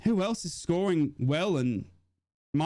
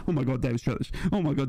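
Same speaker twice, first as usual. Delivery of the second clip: usual speaking voice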